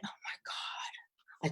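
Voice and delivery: breathy whispered "Oh my God", almost silent; moved, barely voicing it; hushed whisper. Seeing a sad couple in the elevator, whispered aside.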